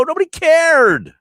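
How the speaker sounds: high, loud emphatic cry; real exasperation, pitch shooting up; exasperated outburst. Real, not acted. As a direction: punch it out at the top of the voice. Emphatic rant, then repeated lower.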